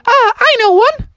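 very high, loud squeaky mouse; little mouse. Acted. Mouse pupil volunteers from the back, high squeaky voice. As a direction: pipe up in a tiny falsetto. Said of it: "a little mouse in the back".

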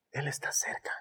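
soft unvoiced whisper. Shown as a demonstration. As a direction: whisper it close to the ear. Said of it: "No necesita gritar".

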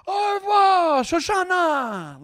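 high, loud call, last word drawn out; imitated yell. Villain yelling after Shoshana as she runs away, imitated holler. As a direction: holler it far across a field, stretching the name. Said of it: "he's yelling to her".

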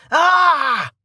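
loud, high "Ah" yell; sustained high cry, falling off at the end; pained cry. A narrator's reading. Bandit crying out as he is tripped, loud yell. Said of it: "one of the bandits cried".

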